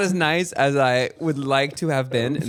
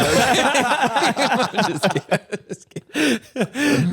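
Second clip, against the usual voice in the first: slow, high, laughing; drawn-out laughing retraction; 9 semitones higher, much slower than usual. Real, not acted. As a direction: giggle it out slowly. Laughing repeated.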